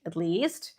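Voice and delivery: usual speaking voice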